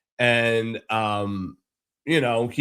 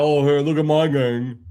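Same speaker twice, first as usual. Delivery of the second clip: a light, slightly louder sneering take on the neighbour's boast; mocking the neighbour; 3 semitones higher, 4 dB louder than usual. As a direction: parrot his smug brag with contempt. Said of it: "thinking he's got".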